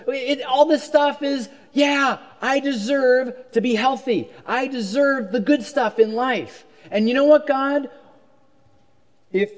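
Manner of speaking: a believer grumbling at God about fairness; entitled complaint. Retold or imitated. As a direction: plead your case as the one who earned it.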